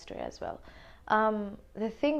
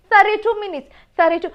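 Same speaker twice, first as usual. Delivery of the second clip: much higher and louder, hammering the number; exasperated disbelief; 11 semitones higher, 12 dB louder, faster than usual. Real, not acted. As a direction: stress thirty-two in outraged disbelief. Outraged over the lost match.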